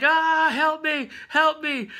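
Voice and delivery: high, drawn-out panicked wail; mock freaking out. Angel thinks his wings are on fire, mock panic. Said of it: "he starts freaking out".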